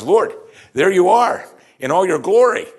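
high, loud eager cry; excited Peter. Retold or imitated. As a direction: burst out with eager joy. Peter voiced, excited exclamation.

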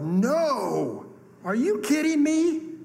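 high, loud, outraged retort; yelling. Retold or imitated. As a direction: yell it in disbelief, drawn out. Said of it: "I yelled".

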